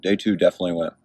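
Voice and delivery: usual speaking voice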